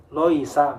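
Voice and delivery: LOISA starting with rounded 'loi'; wrong thick lam. Shown as a demonstration. Teacher shows mistake to avoid, thick first syllable. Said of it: "bukan, bukan loisa".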